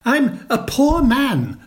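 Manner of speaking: high, slow, timid plea; pleading Hatter. Acted. Hatter pleading with the King, high timid voice.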